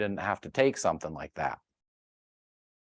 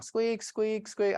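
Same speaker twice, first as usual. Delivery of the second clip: high, squeaky car-noise imitation; imitated squeak; 11 semitones higher, 3 dB quieter, much slower than usual. Describing his car's weird noise, high squeaking imitation. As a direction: squeak like a worn car part.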